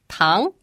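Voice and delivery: rising Mandarin "tang"; second tone. Shown as a demonstration. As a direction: let "tang" climb like a question. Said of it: "The second tone".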